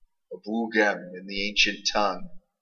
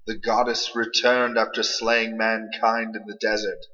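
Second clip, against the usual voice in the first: usual speaking voice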